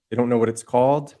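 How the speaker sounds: ordinary talk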